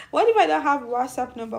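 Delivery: ordinary delivery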